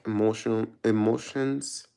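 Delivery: ordinary delivery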